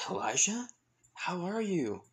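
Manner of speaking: high-pitched, delighted recognition; surprised greeting. Acted. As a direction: greet a long-lost friend, voice lifted high. Wizard King greets an old friend.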